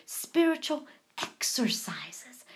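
whispered reading. Acted.

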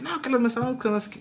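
soft, high-pitched imitation of an argument; light mocking quote of people arguing; mock bickering. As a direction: mimic petty squabbling under the breath. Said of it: "se ponen a discutir".

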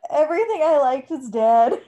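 loud, high lament; mock-grief protest. Real, not acted. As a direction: complain loudly in playful despair. Mock outrage over dead characters.

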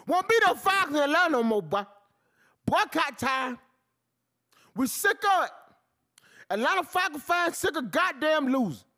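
angry rant. Real, not acted. Fan rant ultimatum.

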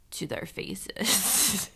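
hushed, drawn-out joking aside; near-whispered punchline. Real, not acted. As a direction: slip it in slowly under your breath. Joking aside after a denial.

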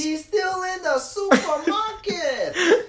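high, slow, drawn-out impression; slow high-pitched comic voice; put-on bit voice. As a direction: stretch it out high, half laughing. Joining in with accent impressions.

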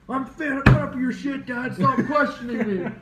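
high, loud mock outburst at Dad, laughing through it; hammed-up teen suddenly yelling back at his father; macho outburst. Imagined son snapping at his questioning dad, hammed-up macho yell. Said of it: "like, all macho".

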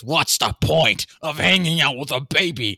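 a put-on mocking voice of the partner; half-teasing mock imitation; mocking quote. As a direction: mimic them, slowing down at the end. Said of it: "specifically saying things like this".

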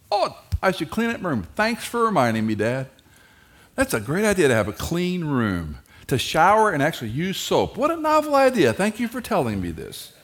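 high, loud mocking teen voice; mock the lecture with heavy sarcasm; sarcastic teen. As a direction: teenager sneering back at dad's lecture.

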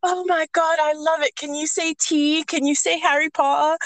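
high, delighted mock gushing; gushing fan. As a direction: imitate a starstruck accent lover. Voices Americans gushing over accent.

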